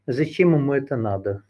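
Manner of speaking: ordinary delivery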